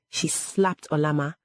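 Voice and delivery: plain reading voice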